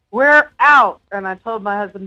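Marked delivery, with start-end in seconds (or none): 0.1-1.0 loud, raised-pitch retold shout; retold yell. Woman retelling fleeing the haunted house, yelled. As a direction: call it out as a yelled announcement. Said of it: "and I just yelled".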